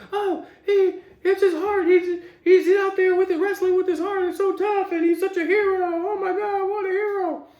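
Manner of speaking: high-pitched, whiny swooning; mock gushing fans. Imitating wrestling watchers fawning over his heart, mock gushing. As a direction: gush in a put-on high wail, mocking. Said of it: "anybody watches X W wrestling".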